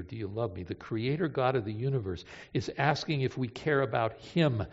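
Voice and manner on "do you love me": soft, low, tender question; quiet plea. Retold or imitated. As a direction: ask it gently under the breath. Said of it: "Jesus says to Peter".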